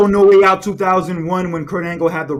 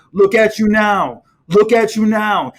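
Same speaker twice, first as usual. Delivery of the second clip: mildly imitated sing-song taunt, louder and higher; 5 semitones higher, 6 dB louder, slower than usual. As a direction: stretch it out slowly as a repeated mocking jeer. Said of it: "trying to do his best [name] impression".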